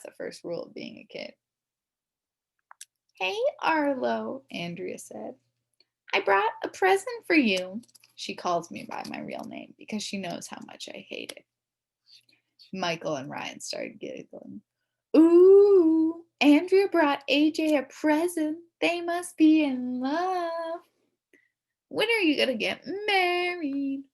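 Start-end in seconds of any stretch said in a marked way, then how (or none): 3.1-7.8 girl teasing a boy with fake sweetness; sugary tease. Girl teasing with his real name. 15.1-20.8 loud, high ooh then slow taunt; singsong teasing. Acted. Friends teasing about love. 21.9-24.0 teasing. Acted. Friend teasing about marriage.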